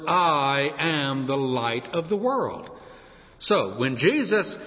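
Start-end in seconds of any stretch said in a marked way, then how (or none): 0.1-2.7 slow, lower, measured "I am"; solemn quote. Retold or imitated. Preacher quoting words only God could say, solemn. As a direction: draw out "I am" slowly and let each word land. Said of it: "could honestly say these words".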